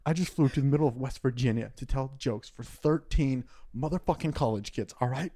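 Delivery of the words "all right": normal talking voice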